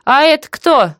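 high, nosy auntie question; interested high-voiced query; curious. A narrator's reading. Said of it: "заинтересованно переспросила Валентина".

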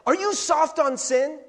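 high, loud taunting question; mocking accusation. Pastor mimicking critics, mocking accusing question. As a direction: sneer it like an accuser. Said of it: "I get accused of that a lot".

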